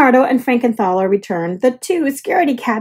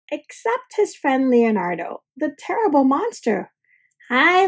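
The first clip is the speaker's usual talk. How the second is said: plain reading voice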